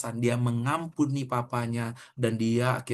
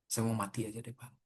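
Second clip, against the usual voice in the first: hushed, softer, defeated voice; despairing student; 3 semitones lower, 11 dB quieter than usual. Retold or imitated. Preacher retells the hopeless student in his office, quiet and broken. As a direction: let it sink as if near tears. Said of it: "Dan dia betul-betul nangis".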